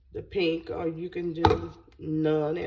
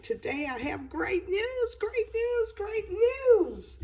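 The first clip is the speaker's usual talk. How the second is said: excited; 13 semitones higher than usual. Real, not acted. Excited big news announcement.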